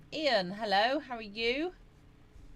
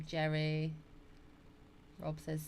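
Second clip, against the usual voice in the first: ordinary delivery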